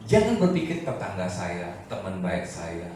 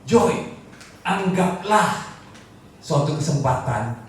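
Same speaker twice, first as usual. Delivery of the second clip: high, loud, drawn-out proclamation; full-force preaching; 11 semitones higher, 7 dB louder, slower than usual. Real, not acted. Preacher declaring endless love and great joy, fervently. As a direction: proclaim it with slow, raised fervour.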